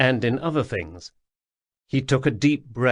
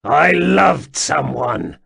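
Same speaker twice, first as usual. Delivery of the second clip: slow, louder pained snarl; 5 semitones higher, 7 dB louder, slower than usual. Acted. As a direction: bite out the words in pain. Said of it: "Sul snarled".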